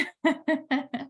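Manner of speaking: a quick, light laugh after the point; short laugh. Real, not acted. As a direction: let out a brief laugh. Laughing at her own point.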